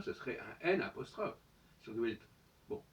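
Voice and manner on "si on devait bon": ordinary delivery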